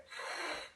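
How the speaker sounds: throaty hissing K sound; back-of-the-throat fricative hiss; cat-like hiss. Shown as a demonstration. Teacher showing the Scouse K, cat-like throaty hiss. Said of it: "almost like a cat hissing".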